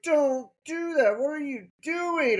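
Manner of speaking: high-pitched mock panic; freaking out. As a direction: imitate a nurse starting to freak out. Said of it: "my nurse started to freak out".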